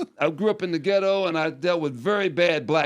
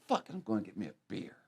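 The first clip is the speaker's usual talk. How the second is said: muttered to himself; 7 semitones lower, 13 dB quieter, faster than usual. Retold or imitated. Retold muttered thought.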